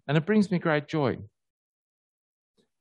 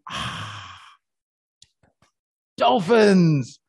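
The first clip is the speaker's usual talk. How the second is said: a hushed breathy "Ah", then a loud, high "dolphins" that falls away; delighted wonder; 5 semitones higher, 4 dB louder, much slower than usual. Retold or imitated. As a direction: catch your breath, then announce the dolphins. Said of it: "you go".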